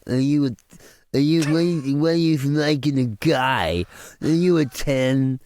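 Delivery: hammed-up spring-break pest, words stretched out; taunting YouTuber. Mocking YouTubers taunting girls at spring break, put-on interviewer voice. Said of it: "just taunting the girls, just going".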